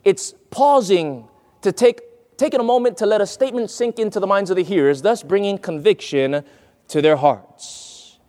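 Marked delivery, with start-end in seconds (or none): none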